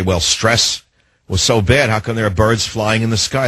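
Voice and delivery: ordinary talk, nothing marked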